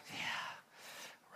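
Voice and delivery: hushed, secretive whisper. Acted. Mary whispering to Jesus about the Pharisees, hushed. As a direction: lean in and whisper the gossip.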